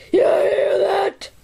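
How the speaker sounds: slow crying shout. Acted. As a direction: yell it out slowly.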